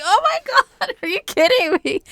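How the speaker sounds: squealing high with a laugh; giddy shriek of laughing delight. Retold or imitated. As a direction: gush like you've just been swept off your feet. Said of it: "And I was like".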